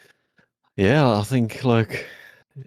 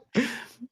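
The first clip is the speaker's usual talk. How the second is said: high giggle; 4 dB quieter than usual. Real, not acted. Laughing at own story.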